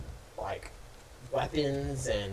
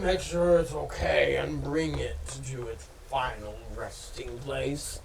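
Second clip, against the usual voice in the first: rat king voice; 3 semitones higher, 7 dB louder than usual. Acted. Rat king speaks, raised voice.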